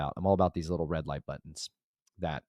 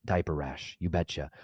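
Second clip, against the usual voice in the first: ordinary talk, nothing marked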